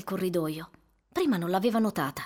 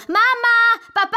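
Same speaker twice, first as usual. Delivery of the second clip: very high, much louder calling; yelling; 14 semitones higher, 10 dB louder, slower than usual. Acted. Bursting home, calls loudly for his parents. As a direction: shout for mom and dad through the house.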